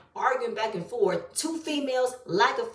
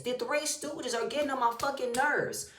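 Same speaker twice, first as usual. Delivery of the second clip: ordinary delivery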